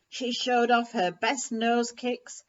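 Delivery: ordinary delivery, nothing marked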